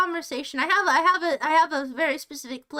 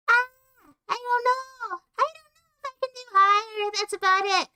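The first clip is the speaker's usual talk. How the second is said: much higher, strained register; 8 semitones higher than usual. Shown as a demonstration. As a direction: push the voice up even higher.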